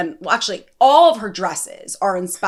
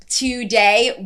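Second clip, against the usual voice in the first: ordinary talk, nothing marked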